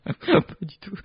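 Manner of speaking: laughing through the words, slow. Real, not acted. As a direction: giggle out the denial. Laughing denial.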